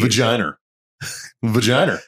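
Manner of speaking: vagina ending in "-er"; added R. Shown as a demonstration. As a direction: put an R on the end of vagina. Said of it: "you add an R".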